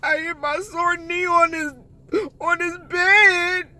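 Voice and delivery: high, loud, slowed blubbering complaint; mock crying whine. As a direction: wail about the sore knee like a baby. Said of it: "I was like".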